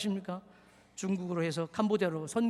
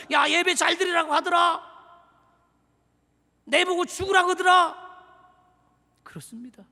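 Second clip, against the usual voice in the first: raised, high sermon voice; fiery preaching; 7 semitones higher, 8 dB louder than usual. Real, not acted. Pastor charging the church to remember this worship, preaching loud. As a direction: preach it hard, rally the congregation.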